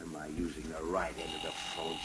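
very low, soft grumble to himself; grumbled mocking quote. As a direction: repeat it sourly under your breath. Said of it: "she says".

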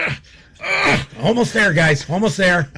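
mock straining groans, pitched high; pained Ditka. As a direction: imitate a coach grunting as his hip goes back in. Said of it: "Dick is like".

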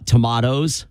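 tomatoes as to-MAH-toes; broad ah in tomatoes; mother-in-law's pronunciation. Retold or imitated. Host quotes his mother-in-law, tomatoes said to-MAH-toes. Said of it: "my mother-in-law says".

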